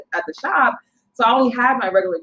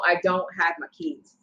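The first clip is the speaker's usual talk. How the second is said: usual speaking voice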